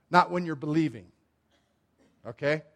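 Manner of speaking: normal talking voice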